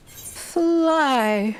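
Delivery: usual speaking voice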